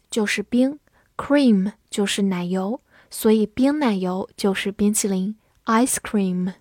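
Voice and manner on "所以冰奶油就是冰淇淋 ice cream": ordinary talk, nothing marked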